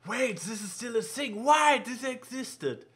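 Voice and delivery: high, slow put-on voice; character voice. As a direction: read it like a cartoon character. Quoting a character line.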